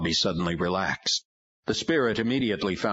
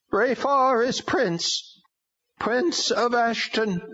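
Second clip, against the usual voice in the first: high, slow strange demon voice; proclaiming demon; 4 dB louder, slower than usual. A narrator's reading. Demon answers.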